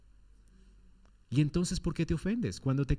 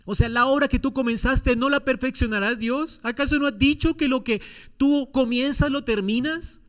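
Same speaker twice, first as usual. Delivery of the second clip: higher, louder pleading to the judge; fervent appeal; 6 semitones higher, 3 dB louder than usual. Acted. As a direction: appeal to God's reputation. Voices Moses appealing to God.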